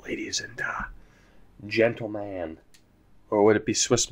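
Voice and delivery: hushed mock announcement; whisper. Gamer whispers ladies and to his audience. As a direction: whisper it like a secret address to the crowd.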